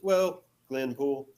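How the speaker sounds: slow, drawn-out hesitant answer. Retold or imitated. Girl answering where she's from, slow hesitant drawl. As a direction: stretch it out like inventing it.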